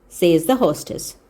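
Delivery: ordinary delivery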